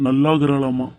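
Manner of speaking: ordinary talk, nothing marked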